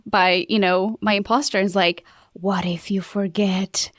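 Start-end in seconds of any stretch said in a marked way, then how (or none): 2.3-3.9 low, soft and slow; whispered inner voice. Retold or imitated. Speaker voicing the imposter in their ear before a talk, low hushed whisper-like voice. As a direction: imitate a whispering doubt, hushed and drawn out. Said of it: "quote that is whispered into my, my ear".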